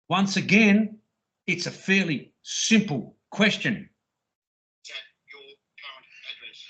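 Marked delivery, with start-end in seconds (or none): 4.8-6.6 quick whisper. Retold or imitated. Quick hushed repeat of question.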